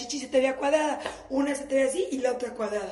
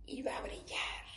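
dreamy whisper; 3 semitones higher, 5 dB quieter than usual. Pretend. Fantasy of husband shining.

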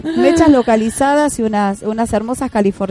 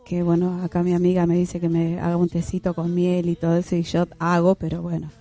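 after wisdom teeth; 4 semitones lower, 5 dB quieter than usual. Real, not acted. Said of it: "tengo muy acá inflamado".

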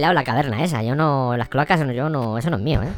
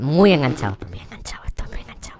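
fading whisper; 3 semitones lower than usual. Acted.

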